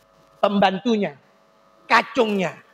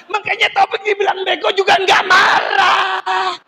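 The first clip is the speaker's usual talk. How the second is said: shouted punchline; 8 semitones higher than usual. Real, not acted. Punchline called out to audience.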